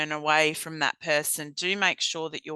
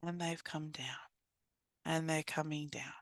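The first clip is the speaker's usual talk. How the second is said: whispered, much softer; hushed calming; 13 dB quieter than usual. Shown as a demonstration. Trainer showing a person settling down, whispered. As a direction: let the voice sink to a hush.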